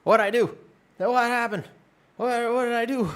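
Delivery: high, loud mock panic; panicked confusion. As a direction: squeal it like you just woke up banned. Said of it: "And I was like".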